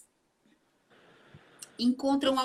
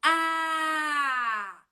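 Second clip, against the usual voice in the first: strong open vowel; 3 semitones higher than usual. Shown as a demonstration. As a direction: call out a long A.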